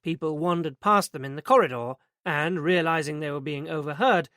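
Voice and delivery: ordinary delivery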